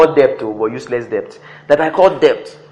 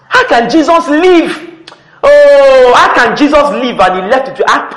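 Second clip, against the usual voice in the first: loud, high-pitched preacher's exclamation; exclaiming in amazement; 9 semitones higher, 8 dB louder, slower than usual. Real, not acted. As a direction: cry out the question, drawing out the opening words.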